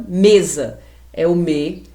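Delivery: "mesa é o me" slowly, with closed ê in me; closed vowel. Shown as a demonstration. Teacher breaks down a word for pupils, "mesa" and "me" with closed ê. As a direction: keep the mouth nearly shut on the e of "mesa" and "me". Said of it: "com a boca quase fechada".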